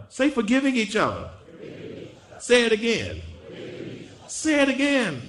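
high call, building to a shout; rousing congregational prompt. Real, not acted. Preacher has the congregation say it back, rousing and building.

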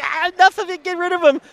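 high-pitched mock emotional outburst; all emotional. Host mimics fans done with the coach, mock emotional. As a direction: imitate a fed-up, overwrought fan. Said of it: "like, all emotional".

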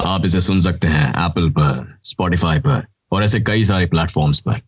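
low, quick hero announcement; Allu Arjun voice. Retold or imitated. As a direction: rattle it off like a swaggering star. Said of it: "in the voice of Allu Arjun".

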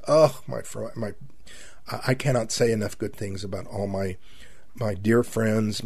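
normal talking voice

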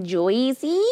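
"Josie" misheard as "Joyzee", rising; imitated puzzled "Josie?" with an oy vowel; puzzled American repeat. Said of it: "That they're like".

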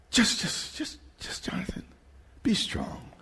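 hushed voice of God coaxing; whispered urging. Acted. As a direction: whisper it like a secret encouragement. God's voice quoted, gently urging.

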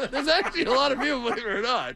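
high, quick words through laughter; real laugh running through the reply. Real, not acted. Co-host insists people are listening, laughing as he speaks.

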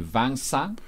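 "vingt-cinq" said softly as vent-sank, nasal French vowels; French number demo. Shown as a demonstration. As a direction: repeat the number cleanly after spelling it. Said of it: "V I N G T and then C".

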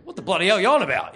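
loud, fast, outraged snap; angry Kiwi retort. Retold or imitated. Cold-called stranger snapping at the caller, loud and fast. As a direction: bark it back like an annoyed stranger.

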